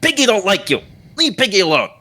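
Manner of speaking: high-pitched, protective little halfling; hostile halfling. Acted. Halfling reining in his pig, wants nothing to do with you, hostile. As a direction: warn them off in a high voice. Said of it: "clearly just doesn't want anything to do with you".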